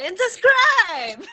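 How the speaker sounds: excited announcer shout. Acted. Excited sign-off, loud.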